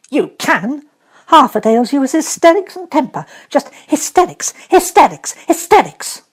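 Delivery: high, loud, hammering each hysterics; scornful girl scolding; stamped scolding. Acted. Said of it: "She stamped each time she said it".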